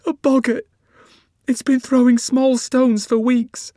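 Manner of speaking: high, loud, tearful explaining; tearful woman. Acted. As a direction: explain through tears, pitched high. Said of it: "she explained".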